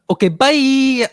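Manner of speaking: playful loud farewell; mock dismissal. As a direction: send them off with a cheery brush-off. Acting out dismissing the friend.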